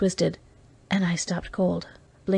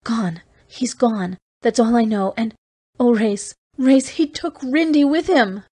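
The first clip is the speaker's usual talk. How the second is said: near sobbing; 4 semitones higher, 4 dB louder than usual. Acted. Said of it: "From the tone of her voice I had thought she was sobbing".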